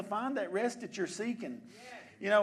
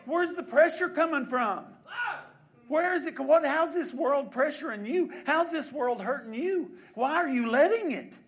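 loud, raised-pitch urgent remonstrance; full-force preaching as God; 8 semitones higher, 10 dB louder than usual. Acted. God to believers burdened by the world's pressure, loud impassioned reproach. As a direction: preach it hard, as God questioning his own.

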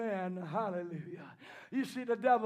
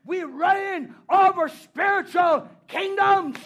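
preaching at full force; 7 semitones higher, 9 dB louder than usual. Acted. Preacher to the congregation, swelling into a shouted refrain. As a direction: build the refrain louder and higher, driving it home.

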